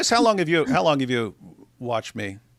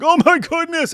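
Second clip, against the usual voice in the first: mock gasp; 12 semitones higher, 6 dB louder than usual. Mocking shocked listeners.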